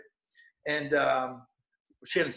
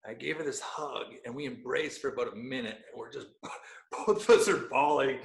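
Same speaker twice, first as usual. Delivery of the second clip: ordinary talk, nothing marked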